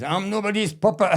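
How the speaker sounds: high, indignant mock protest; indignant retort. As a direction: toss it back like a proud boss. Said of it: "he brings it in and he says".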